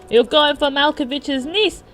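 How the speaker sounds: high, loud character outrage; screaming in accent. Acted. John at a catcaller in a car, outraged accent. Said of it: "screaming at him in that peculiar accent".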